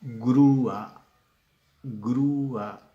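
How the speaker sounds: "grúa" with a loud, long stressed ú; hiatus in grúa. Shown as a demonstration. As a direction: draw out GRÚ, then a separate a.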